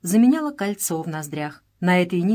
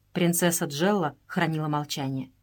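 plain reading voice

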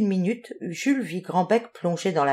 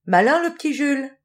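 higher, louder, pleased with himself; proud of himself; 6 semitones higher, 3 dB louder than usual. Acted. Jules praising his own flashlight, proudly. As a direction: brag a little, chin up. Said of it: "ajouta-t-il fier de lui".

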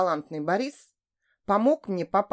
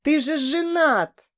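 high, slow, tearful accusation; sobbing out; 7 semitones higher, 3 dB louder, slower than usual. A narrator's reading. Hurt woman accusing her lover of being married, tearful. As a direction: choke it out on a sob. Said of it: "всхлипнула я".

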